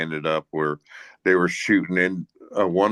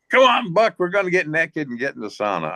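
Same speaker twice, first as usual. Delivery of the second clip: put-on drunken call; 10 semitones higher than usual. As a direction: holler at your buddy to come along. Drunk players calling him over.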